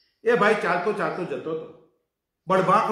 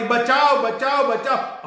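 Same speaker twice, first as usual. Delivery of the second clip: louder, higher, slow drawn-out calls for help; desperate cry for help; 8 semitones higher, 6 dB louder, slower than usual. Acted. Girl calling out a third time, slow and desperate. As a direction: stretch each cry out in desperation. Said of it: "ત્રીજી વખત બૂમ પડી".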